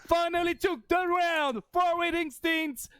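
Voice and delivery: rising, hyped caster call; excited play-by-play. Real, not acted. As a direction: build the call up to a shout. Esports play-by-play climax.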